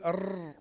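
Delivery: "أر" with the tongue tip trilling on the ر; vibrating raa. Shown as a demonstration. Teacher showing an uncontrolled first attempt, vibrating ر on "أر". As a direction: let the ر of "أر" shake freely. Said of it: "دي بتتهز كده".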